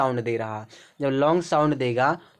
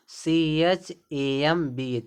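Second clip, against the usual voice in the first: slow letter-by-letter spelling; much slower than usual. Shown as a demonstration. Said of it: "माँ का sound आ रहा है ना".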